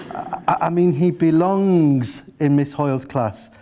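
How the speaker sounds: BELONGS stretched long and slow; teacher correcting herself, leaning hard on "belongs"; flustered correction. A narrator's reading. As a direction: stammer in, then draw out the word belongs.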